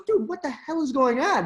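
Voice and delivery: retelling a friend's shocked outburst; imitating stunned friend. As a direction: play the buddy who can't believe it. Friend stunned by the booking email.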